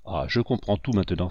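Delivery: usual speaking voice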